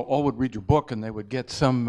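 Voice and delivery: ordinary talk, nothing marked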